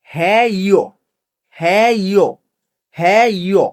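long first vowel in "হ্যাঁ ইয়ো", falling at the end; pronunciation demo. Shown as a demonstration. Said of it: "আমরা আবার উচ্চারণগুলা করি".

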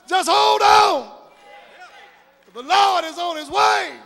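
ringing exhortation with long held words; rousing preaching. Real, not acted. Preacher telling the congregation to hold on, rousing exhortation. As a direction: lift the voice and stretch the promise out.

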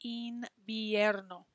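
invierno slowed, een-bee-EHR-no. Shown as a demonstration. Teacher spelling out invierno slowly for the class. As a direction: draw out invierno with both I's as ee. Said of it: "Two I's in that word".

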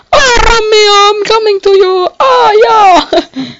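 mock swooning lover. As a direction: cry out to Romeo with exaggerated longing. Lover calling Romeo.

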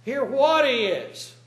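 high, loud, slow retort; mock master's reply. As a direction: draw it out, incredulous.

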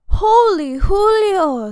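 high, loud, drawn-out squeal; excited squeal. A narrator's reading. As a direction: stretch it out in a big squeal. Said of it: "squealed the kitty boy".

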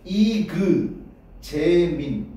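'이그, 재민'을 음절마다 끊어서; '이그'의 '그'를 분명히, '재민'은 길게; '그' 발음. Shown as a demonstration. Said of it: "이건 그 발음이야". ('이그 / 재민' 나눠 발음)

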